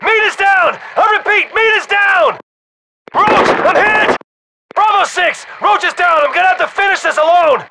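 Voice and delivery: high-pitched, desperate combat yell; urgent shout. Acted. Soldier telling Bravo Six men are down, desperate high shout. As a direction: yell casualty reports over the radio under fire. Casualties reported under fire.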